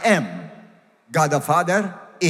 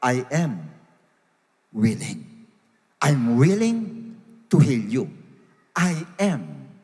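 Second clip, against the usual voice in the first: low, slow, weighty quote; voicing a measured, assured reply to the leper; Jesus; 6 semitones lower, slower than usual. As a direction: lower the voice and leave solemn pauses. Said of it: "Tsaka niya sinabi".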